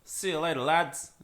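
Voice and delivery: a shaky attempt at an Irish accent. Shown as a demonstration. Host attempting the requested line in an Irish accent. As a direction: try an Irish accent, not very well. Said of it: "what's your best Irish accent".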